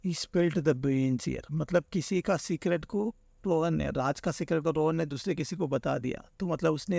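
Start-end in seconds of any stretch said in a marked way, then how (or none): 0.0-1.5 slow. Shown as a demonstration. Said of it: "इसने क्या किया".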